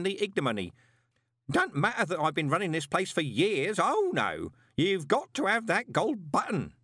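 high, worked-up grumbling to himself; aggrieved postman. A narrator's reading. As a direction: act out a mock-grand interrogation by ancestors. Postman imagines forefathers questioning him.

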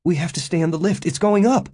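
fast, high and anxious; high, rushed plea; urgent. A narrator's reading. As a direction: hurry him along, rising in alarm. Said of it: "Janet tugged at him urgently".

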